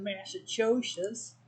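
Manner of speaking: mock Boston accent, slow and drawn out; bad Boston accent. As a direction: stretch it out in a hammed-up Boston accent. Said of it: "Sorry, horrible accent".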